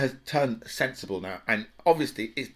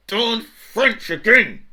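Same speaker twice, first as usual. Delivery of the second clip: drawn-out, much higher and louder, hammed-up disgust; mock exasperated grumble; 10 semitones higher, 8 dB louder, much slower than usual. Mocking the French over Brexit news, slow hammed-up grumble. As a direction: sneer it out like a comic villain.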